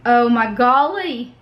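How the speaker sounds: ordinary delivery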